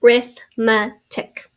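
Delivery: Arithmetic slowly, as A, RITH, ME, TIC; slow four-beat sounding out; in syllables. Shown as a demonstration. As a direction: say Arithmetic with a gap after each part. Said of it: "Four syllables".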